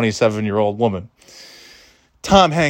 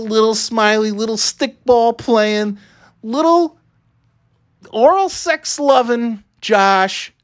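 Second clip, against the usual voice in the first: mock sweet; 7 semitones higher, slower than usual. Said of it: "mock cute epithets for Josh".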